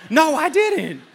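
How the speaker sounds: high, loud playful protest; comic loud denial. Pretend. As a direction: deny it in mock alarm. Loudly retracts his joke.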